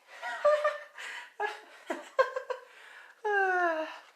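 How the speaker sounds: very high drawn-out cackle; long Joker-like giggling laugh; crazed villain cackle. Acted. Gremlin after announcing his name, crazed high cackle.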